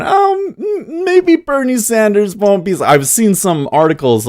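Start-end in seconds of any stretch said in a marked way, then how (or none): 0.0-2.8 high, mocking falsetto of a hopeful trader; mock Wall Street guy. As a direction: squeak it out like a naive banker. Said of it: "and be going".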